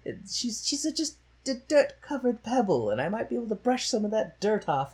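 mock posh Higgins; Higgins impression. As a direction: savour it like a condescending snob. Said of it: "quoting Higgins".

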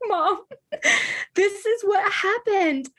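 put-on high little-kid voice telling mom; a kid running to mom, upset; childlike voice. As a kid telling her mom at the library, high and plaintive.

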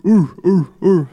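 punchy, repeated crowd-style whoops; Arsenio Hall. Retold or imitated. Host recalling a talk show, imitated whooping. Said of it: "like, do the ooh".

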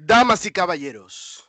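booming Spanish ring announcer, high and loud; lucha libre announcer. Pretend. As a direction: stretch out the name like a ring intro. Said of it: "why don't you tell them who it is?".

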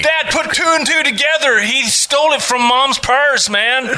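put-on tattling kid. Sketch kid tattling to dad.

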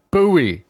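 [name] said as BOO-ee; disputed pronunciation. Shown as a demonstration. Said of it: "nobody pronounces it [name]".

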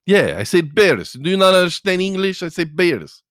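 put-on exasperated Russian accent, high and loud. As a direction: snap back in a thick mock Russian accent. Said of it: "he had that Russian accent".

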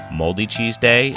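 ordinary talk

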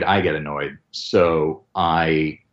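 usual speaking voice

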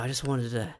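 low, quiet creepy confession; creepy whisper. Retold or imitated. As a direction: murmur it like a psycho.

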